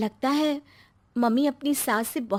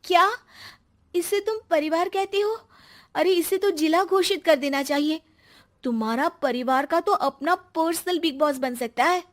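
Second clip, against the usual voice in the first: much higher, amazed and mocking; incredulous teasing; 8 semitones higher, 4 dB louder than usual. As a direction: play up the disbelief. [name] mocks the huge family. (हैरानी भरा मज़ाकिया लहजा)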